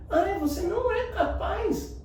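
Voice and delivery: high, slow idiotic voice; mock it slowly in a goofy high pitch; drawn-out, high-pitched silly teasing; silly different voice. Shown as a demonstration. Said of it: "precisa ser uma voz diferente".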